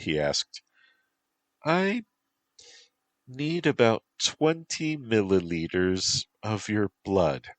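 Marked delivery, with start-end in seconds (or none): 1.6-7.5 high, whistling creature voice. A narrator's reading. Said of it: "in her whistling voice".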